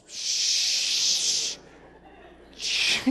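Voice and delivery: hushed, breathy shush. Retold or imitated. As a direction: hush him in the library. Parent hushing loud teen.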